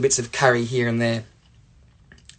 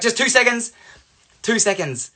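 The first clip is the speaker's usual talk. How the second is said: higher, pleading repeat; calling over; 8 semitones higher than usual. Retold or imitated. Dad persuading hesitant teacher to step in, insistent. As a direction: re-enact a persistent polite summons.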